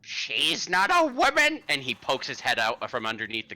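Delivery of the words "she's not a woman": put-on high, wailing old-man voice, slow; a frantic, bone-robbed geezer crying out; shrill hysterical wail of a ranting elder; crazed old man.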